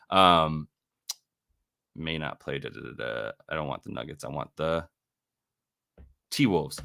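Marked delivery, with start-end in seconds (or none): none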